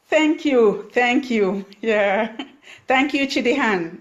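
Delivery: high, loud, delighted thank-yous; bright thanks. Real, not acted. Host thanking live viewers, high and delighted. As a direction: beam at the viewers as you thank them.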